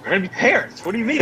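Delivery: high-pitched, elated comeback; excited, laughing incredulity; retold thrilled disbelief. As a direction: answer her worry with giddy joy. Retold thrilled reply to worried wife.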